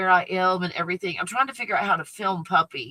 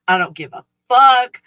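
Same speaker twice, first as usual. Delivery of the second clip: louder, higher defiant yell; loud defiant shout; 5 semitones higher, 10 dB louder than usual. Shown as a demonstration. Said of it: "anybody else here want a".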